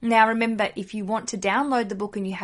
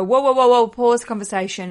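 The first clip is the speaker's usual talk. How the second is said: loud, higher "Whoa, whoa" stop call; louder mock halt in the office; 3 semitones higher, 9 dB louder than usual. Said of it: "I go".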